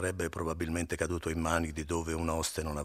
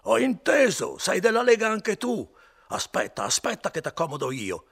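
put-on much higher, loud drunken ranting; louder still; 10 dB louder than usual. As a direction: threaten him at the top of your voice. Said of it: "Ma Renzo continuava più forte".

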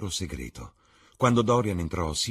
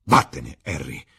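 sharp louder outcry; Dorian cries out; 3 semitones lower, 9 dB louder than usual. Acted. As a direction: push him away with a shout. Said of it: "gridò il ragazzo". (Dorian grida a Harry, più forte)

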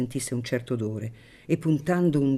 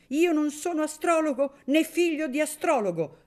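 high, forceful outburst; shouting; 13 semitones higher than usual. A narrator's reading. Paglietta defending his reasoning to the guests, heated shout. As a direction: shout it, wild-eyed. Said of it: "gridò il Paglietta".

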